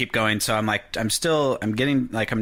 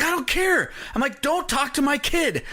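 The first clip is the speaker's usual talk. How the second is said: furious retort. Retold or imitated. Dad telling off the stranger about his kid. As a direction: snap back in protective fury.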